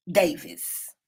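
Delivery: ordinary talk, nothing marked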